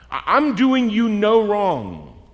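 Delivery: slow, drawn-out firm rebuttal; stern. Acted. Landowner answering a grumbling worker, stern. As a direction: deny it slowly and firmly. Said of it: "it's a little stern".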